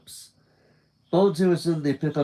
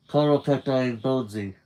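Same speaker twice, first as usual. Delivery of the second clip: usual speaking voice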